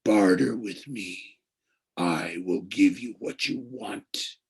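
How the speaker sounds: slow, heavy voice from the pit; slow cold offer from the dark; heavy tempting voice. A narrator's reading. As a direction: tempt him slowly, like lead. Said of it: "It wrapped around me like sheets of lead".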